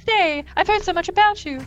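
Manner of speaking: high, eager pleading; eager Minuet. Acted. Minuet urging Picard to stay, eager and high. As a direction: beg the guest to stay in a high voice. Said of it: "Minuet's totally into it. She's like".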